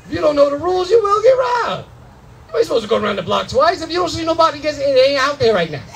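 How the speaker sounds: normal talking voice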